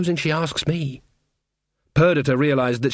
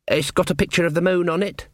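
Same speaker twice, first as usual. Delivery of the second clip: hopeful; 4 semitones higher than usual. Acted. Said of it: "in a hopeful voice".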